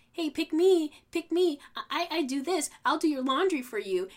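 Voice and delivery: high, loud, eager mock girly voice; squealing pick me, pick me parody; mock pick-me girl. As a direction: ham up a desperate girl begging to be chosen. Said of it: "They're like".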